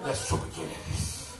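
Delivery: slow, low menacing whisper. Pretend. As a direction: breathe it like a threat. Mock sinister answer.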